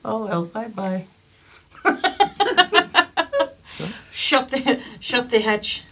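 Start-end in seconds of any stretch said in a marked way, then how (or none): 1.8-3.5 big burst of laughter; loud laugh. Real, not acted. Laughs at joke. 4.1-5.8 loud command. Acted. Orders hatch shut.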